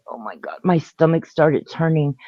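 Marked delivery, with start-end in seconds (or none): 0.1-0.6 very low, very soft exclamation; retold fearful murmur; hushed dread. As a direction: breathe it out almost inaudibly.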